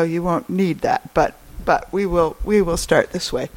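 projecting to the room without a mic; professor voice. Real, not acted. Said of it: "I can use my professor voice".

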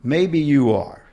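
slow mild proclamation; proclaimed. Acted. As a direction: declare it slowly, a light touch of grandeur. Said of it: "I proclaimed".

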